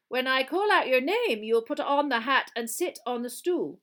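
loud, formal schoolmistress announcement; teacher's instructions. A narrator's reading. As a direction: announce it firmly to a hall of first years. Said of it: "she told the first years".

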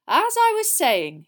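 high, loud, slow resumption of a speech; Dumbledore addressing the hall. Acted. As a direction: regain the hall's attention grandly.

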